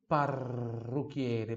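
parrucchiere very slow, rr trilled, chi as ki; slow drill. Shown as a demonstration. Teacher drilling parrucchiere very slowly. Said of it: "o C H I, que tem som de qui".

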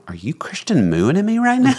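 high, surprised mock question; surprised joking at wife; incredulous teasing. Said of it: "I went".

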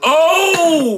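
loud high surprised yelp; excited shout. Real, not acted. Remembering the new podcast, high excited shout. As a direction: blurt it out in excitement.

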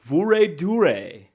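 "Vorrei due" slow, loud and high; "Vorrei due" drawn out with -ay endings; emphatic Italian demo. Shown as a demonstration. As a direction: stretch out "Vorrei due", each word punched. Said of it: "That is where due came from".

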